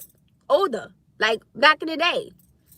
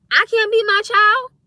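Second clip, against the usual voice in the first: high, loud, incredulous challenge; indignant disbelief; 9 semitones higher, 7 dB louder than usual. Real, not acted. Parent challenges critics about disciplining child, indignant. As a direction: throw it back as an outraged question.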